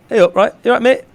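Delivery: high, bright mock Yorkshire hello; a cheery put-on northern "Hey, up"; northern greeting. Imitating friendly locals to her friend, mock northern. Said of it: "real sound and friendly and just like".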